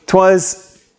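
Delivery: 'Twas said as one clipped syllable, tw-as; contraction 'twas. Shown as a demonstration. Teacher introducing the old contraction, 'Twas said as one syllable. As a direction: say 'Twas as a single beat. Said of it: "not many people use this".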